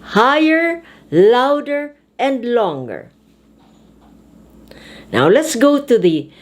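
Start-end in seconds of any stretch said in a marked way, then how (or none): 0.0-3.1 "Higher", "louder" held high and long, "and longer" falling; stressed-syllable demo. Shown as a demonstration. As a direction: list "Higher, louder" on a raised, sustained pitch, then drop on "longer". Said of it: "should be pronounced louder, higher".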